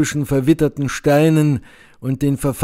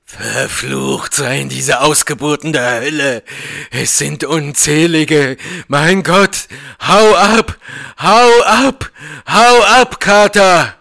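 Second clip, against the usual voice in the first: scream into shriek; 2 semitones higher, 5 dB louder than usual. A narrator's reading. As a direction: start strained, then shriek the warning in terror. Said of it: "in ein Kreischen verwandelte". (entsetzt, bis zum Kreischen gesteigert)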